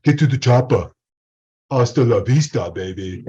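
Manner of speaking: put-on loud action-hero Austrian accent. Teacher quoting action films for accents, Austrian accent. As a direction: imitate an Austrian movie tough guy. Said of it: "I could use accents".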